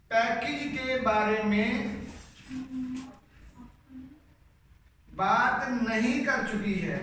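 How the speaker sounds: slow, word by word for the class to write; slow dictation. Shown as a demonstration. Teacher dictates the negative sentence to students, slowly. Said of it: "अब मैं इसका मंफी बनाने लगा हूँ".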